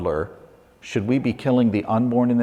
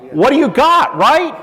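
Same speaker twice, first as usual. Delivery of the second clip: raised, much higher voice; loud parental demand; 12 semitones higher, 6 dB louder than usual. Acted. Parent asks the child what he has caught, loud demand. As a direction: call it out like a parent checking up.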